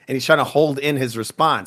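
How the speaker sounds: usual speaking voice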